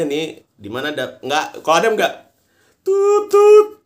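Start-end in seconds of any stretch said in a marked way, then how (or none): 2.8-3.8 high mock phone tone; phone beep imitation. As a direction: beep like a dial tone.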